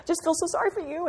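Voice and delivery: high, sugary fake sympathy; sing-song pity that coddles a friend through her hard day; mock syrupy pity. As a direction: coo it like a pitying church lady. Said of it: "when we allow each other to be".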